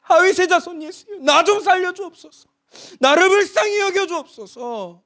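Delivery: high, loud, pleading shout; crying out again. Acted. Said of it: "또 외칩니다". (굴하지 않고 다시 외치는 간절한 부르짖음)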